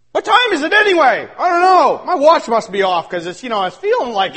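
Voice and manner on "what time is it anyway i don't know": ordinary delivery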